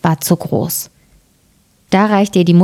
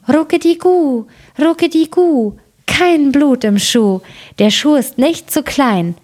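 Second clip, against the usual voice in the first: high, cooing dove call; rufende Täubchen; 7 semitones higher than usual. A narrator's reading. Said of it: "riefen die zwei weißen Täubchen". (hoher, gurrender Taubenruf)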